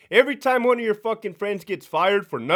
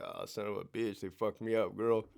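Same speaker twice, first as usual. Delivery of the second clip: much softer, low, worn-out moan; beaten groan; 8 semitones lower, 12 dB quieter than usual. Retold or imitated. Boxer after twelve rounds moaning to his crying wife, soft and beaten. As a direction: mumble it like a beaten fighter.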